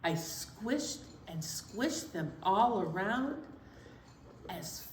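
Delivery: slow, measured rhythmic recital; reading a color poem to children. Acted. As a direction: read the rhyme again for the kids.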